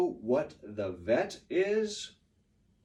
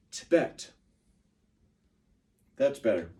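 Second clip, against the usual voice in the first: ordinary talk, nothing marked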